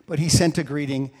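normal talking voice

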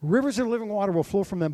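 high, loud and fast; preaching shout. Acted. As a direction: shout it out like a revival call. Preacher repeats Jesus' cry.

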